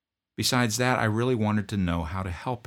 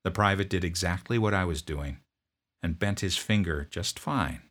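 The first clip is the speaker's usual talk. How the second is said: ordinary delivery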